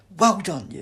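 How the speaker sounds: loud, high congratulations; Doctor congratulating Sid; delighted praise. A narrator's reading. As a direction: cheer him on.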